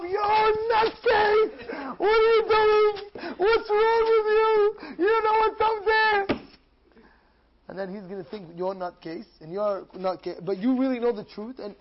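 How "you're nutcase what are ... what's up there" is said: high, drawn-out loud yelling; slow, stretched high-pitched shouting; frantic berating. Acted. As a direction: yell at your friend like a crazed zealot. Crazed man berates his friend.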